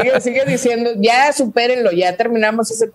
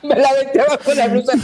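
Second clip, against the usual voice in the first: laughing; 4 semitones higher than usual. Real, not acted. Laughing after spilling water.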